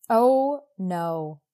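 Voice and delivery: normal talking voice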